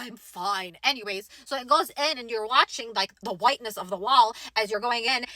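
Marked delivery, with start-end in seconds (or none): 0.0-0.8 lower, quieter, controlled insistence; low, composed reassurance. Retold or imitated. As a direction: voice brought down low and soft. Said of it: "my voice is getting up and high like that".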